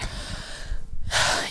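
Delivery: a long, soft breath out before answering; heavy sigh. Real, not acted. Guest sighing before answering the host's question about October. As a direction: let a weary sigh go before you start.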